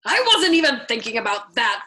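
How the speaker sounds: a loud, startled outcry; cried out. A narrator's reading. Colonel alarmed at the chaplain's suggestion, crying out. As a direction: blurt it out in alarm. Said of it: "cried the colonel".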